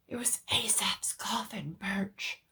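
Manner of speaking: hushed, urgent whisper; shuddering whisper. Acted. Doctor wakes his patient, whispering in horror. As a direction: breathe it out in horror. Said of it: "a succession of shuddering whispers".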